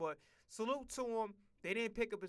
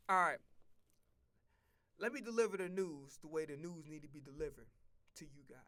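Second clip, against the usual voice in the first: ordinary talk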